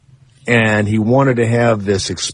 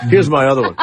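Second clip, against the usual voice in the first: ordinary talk, nothing marked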